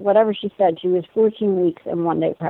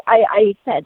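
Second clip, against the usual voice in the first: usual speaking voice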